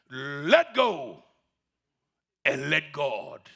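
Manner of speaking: low, slow commanding voice; voice of God. Acted. God tells the climber to let go, low and slow. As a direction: pronounce it slowly as the Lord. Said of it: "And the voice said, God speaking".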